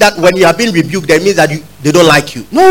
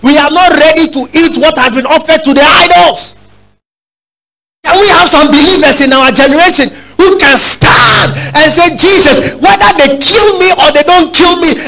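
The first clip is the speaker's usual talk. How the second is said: high, loud, shouting sermon climax; fiery preaching; 8 semitones higher, 3 dB louder than usual. Acted. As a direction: cry it out high, daring the church to stand. Preaching at full force.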